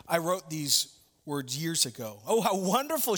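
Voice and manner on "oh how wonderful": higher, delighted exclamation; bright gasp of delight at the coincidence; exclaiming. Acted. Woman in the cab delighted to meet the poet, exclaiming. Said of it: "she exclaimed".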